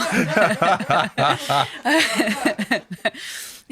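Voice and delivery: long, helpless laugh at your own story; amused, sustained laughter; real laugh. Real, not acted. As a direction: burst out laughing and keep it going.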